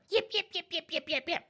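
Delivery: very high-pitched rapid yips; dog yipping. Retold or imitated. Storyteller mimicking the dog in the kitchen, shrill yipping. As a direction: imitate a small dog yapping. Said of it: "all of a sudden she's hearing".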